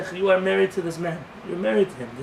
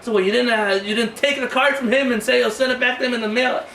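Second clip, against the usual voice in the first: louder, higher scolding; scolding wife; 6 semitones higher, 5 dB louder than usual. Retold or imitated. Wife scolding husband for not taking the card, louder.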